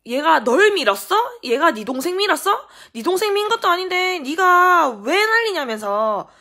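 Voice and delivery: high, loud, hectoring questions; retold mother yelling in a quarrel; angry retort. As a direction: hammer each question at the child. Mother shouts back in the quarrel.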